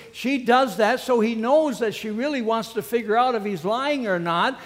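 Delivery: ordinary talk, nothing marked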